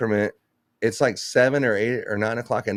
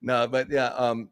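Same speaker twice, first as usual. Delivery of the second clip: usual speaking voice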